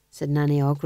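ordinary delivery, nothing marked

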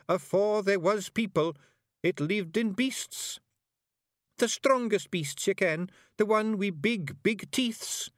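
high, slow Scots storyteller; eerie Feegle tale. A narrator's reading. Awfully Wee Billy warns the Feegles and Miss Level, high and slow in Scots. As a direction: make the hiver creep.

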